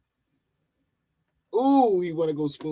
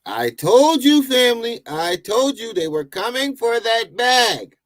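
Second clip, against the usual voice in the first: raised, loud "I told you" to the viewers; excited gloating; 7 semitones higher, 5 dB louder than usual. Real, not acted. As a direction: gloat loudly like you called it. Excited warning he saw coming.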